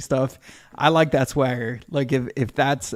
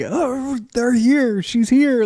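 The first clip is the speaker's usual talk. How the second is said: excited; 10 semitones higher, 4 dB louder, much slower than usual. Retold or imitated. Excited radio listeners quoted.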